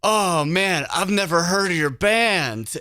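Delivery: high, cheerful dude voice; stoner rocker. Retold or imitated. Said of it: "And he goes".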